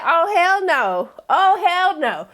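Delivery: slow, high, drawn-out refusal; retold outrage. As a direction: stretch each word in hammed-up indignation.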